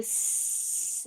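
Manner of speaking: soft unvoiced whistle-hiss; teacher imitating a pressure cooker. Shown as a demonstration. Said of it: "اور کسی کی ایسی ہوتی ہے".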